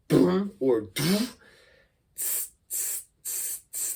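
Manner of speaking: slow boom, then airy tss; beatbox sound demo; beatbox bass and hi-hat. Shown as a demonstration. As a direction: demo each mouth drum sound. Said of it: "ideas for doing the bass sound".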